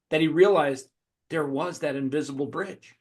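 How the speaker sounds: ordinary talk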